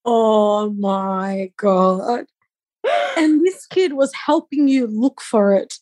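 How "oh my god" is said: slow, lower, drawn-out gasp of disbelief; real disbelief, slow and low; stunned. Real, not acted. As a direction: let the shock stretch every word. Shocked at the son's trick.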